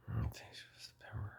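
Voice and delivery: whispered correction to himself; quick whispered self-correction; whispered aside. Real, not acted. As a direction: drop to a whisper as if thinking aloud.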